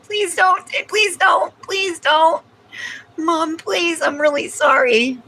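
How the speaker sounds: very high begging puppy voice; sorry pup begging mom; pleading dog voice. Pretend. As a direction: plead like a guilty little dog. Voicing the guilty dog begging.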